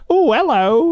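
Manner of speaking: high-pitched playful mock hello; mock camp greeting. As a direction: sing out the greeting in a put-on high voice. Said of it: "he almost certainly has at least once gone".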